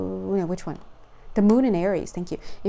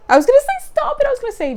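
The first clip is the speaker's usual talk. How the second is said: very high, loud squeal; excited squeal; 10 dB louder, much faster than usual. Real, not acted. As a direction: squeal with delight at the card. Excited reaction to card.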